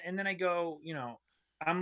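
ordinary talk

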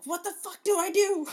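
panicked mother. Retold or imitated. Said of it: "she's like".

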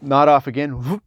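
normal talking voice

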